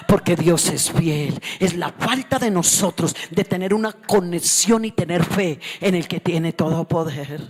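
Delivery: slow, booming shouted sermon; preaching at full force. Acted. Preacher urging the sisters to have faith, slow and booming. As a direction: hammer each word to the church.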